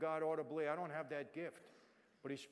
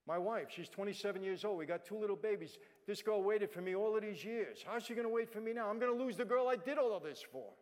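anguished outburst; 6 semitones higher, 7 dB louder than usual. Retold or imitated. Reliving despair in cell.

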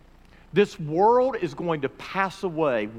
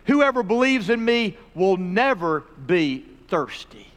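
loud, raised preaching voice; forceful preaching; 5 semitones higher, 5 dB louder than usual. Acted. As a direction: proclaim the scripture with punch on key words. Preacher proclaims Jesus' words at full force.

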